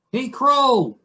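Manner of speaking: high-pitched moose calling out; demanding moose. Acted. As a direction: holler up to a crow in a tree. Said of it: "say the moose".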